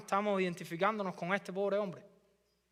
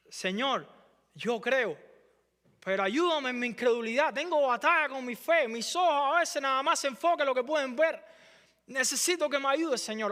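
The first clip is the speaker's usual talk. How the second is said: higher, louder pleading prayer; pleading father; 6 semitones higher, 4 dB louder than usual. Acted. Father praying to the Lord, higher and pleading. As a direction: beg the Lord for help with faith.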